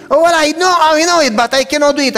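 high, loud flustered excuse; mock flustered landlord. Landlord squirms, retold.

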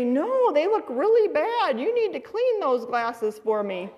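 singsong, swooping imitation of a teasing dad; mock-helpless husband. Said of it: "He'd say".

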